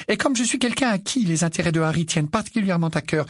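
high, tense motherly protest; outnumbered mother. A narrator's reading. Mother defends her place in Harry's life, high and strained. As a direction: hold your ground though nobody backs you.

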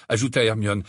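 plain reading voice

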